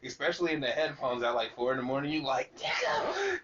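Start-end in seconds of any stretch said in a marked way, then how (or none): 2.6-3.4 very high, hammed-up lyric shout; imitating the rapper. Showing how the song hits in headphones, exaggerated high imitation. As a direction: stretch the last phrase out high and slow. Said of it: "compared to how he said it".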